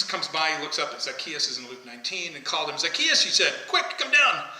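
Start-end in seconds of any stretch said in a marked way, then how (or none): 2.8-3.2 high, louder call up into a tree; Jesus calling Zacchaeus down from the tree, raised call. Retold or imitated. 3.7-4.5 high, louder call up into a tree; Jesus calling Zacchaeus down from the tree, raised call. Retold or imitated.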